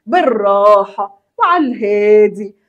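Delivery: drawn out, taking all the time in the world; slow and easy. Shown as a demonstration. Teacher showing students to take it slowly, drawn-out and easy. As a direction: stretch each word out gently. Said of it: "لازم إن أنت تبقى بالراحة وعلى الهادي".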